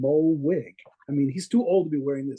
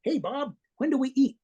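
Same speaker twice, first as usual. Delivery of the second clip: imitating Joe Shuster; 10 semitones higher than usual. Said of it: "looked at him and goes".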